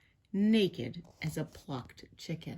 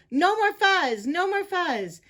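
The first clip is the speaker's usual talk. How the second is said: high, loud crowd chant; emphatic repeated shout; yelling; 10 semitones higher, 5 dB louder than usual. A narrator's reading. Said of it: "the crowd yelled".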